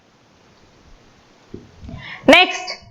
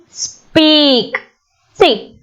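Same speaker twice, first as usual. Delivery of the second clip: ordinary talk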